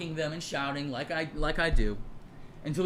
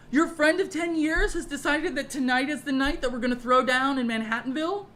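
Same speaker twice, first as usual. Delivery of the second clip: much higher and louder, incredulous anger; indignant outburst; 10 semitones higher, 6 dB louder than usual. Retold or imitated. Yelling at her friends on the street, outraged and disbelieving. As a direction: fire it back, outraged and disbelieving. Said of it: "And I was like".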